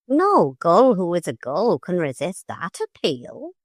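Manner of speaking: lightly voiced gull; a hint of a proud sea bird; gull master's voice. A narrator's reading. Master gull accepts the parrot's appeal, lightly voiced.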